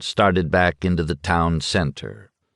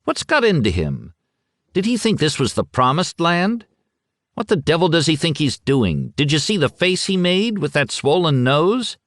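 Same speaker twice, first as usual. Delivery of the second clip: much higher, jeering voice; mocking chatter; 10 semitones higher than usual. A narrator's reading. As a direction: make fun of the swollen nose. Petrina mocking Futaki.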